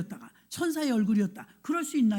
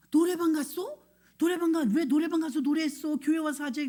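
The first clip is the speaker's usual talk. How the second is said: high-pitched mock disbelief; incredulous scolding; 5 semitones higher, 4 dB louder than usual. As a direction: retell a startled telling-off, voice raised in pitch. Retold scolding of the student. (어이없어하며 나무라는 흉내)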